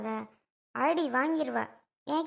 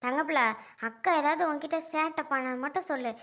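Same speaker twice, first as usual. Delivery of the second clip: ordinary talk, nothing marked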